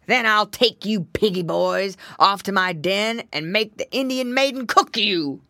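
loud bear threat in a growly voice; growling, grumbling bear. A narrator's reading. Bear threatening to carry off piggy boys, growling. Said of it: "growled and grumbled the bear".

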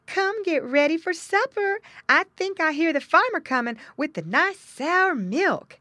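high, loud summons; mother pig calling. A narrator's reading. Mother pig announces supper to her young, calling out. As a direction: call the piglets in, high and strong. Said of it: "called Missus Pig in her grunting voice".

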